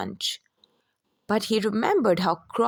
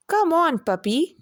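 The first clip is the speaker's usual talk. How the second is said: calling out; 6 semitones higher than usual. A narrator's reading. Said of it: "cried the boy".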